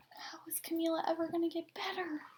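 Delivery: hushed storyteller whisper; whisper aside. A narrator's reading. Reading to a child, whispering an aside.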